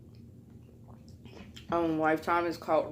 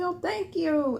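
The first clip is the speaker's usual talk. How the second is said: high-pitched polite thank-you; sweetly pitched-up acted reply; retold pleased thanks; 12 semitones higher, slower than usual. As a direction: imitate yourself chirping thanks.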